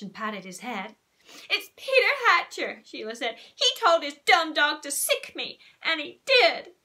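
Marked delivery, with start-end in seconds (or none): 1.5-6.7 lightly voiced whiny girl, higher and louder; a hint of a bratty little girl complaining; tattling girl Sheila. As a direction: tell on him to the grown-up.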